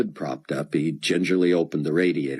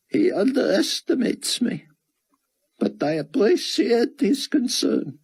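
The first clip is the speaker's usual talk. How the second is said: worried fugitive. Acted. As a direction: speak as a hunted man fearing for friends. Character voice held over many lines.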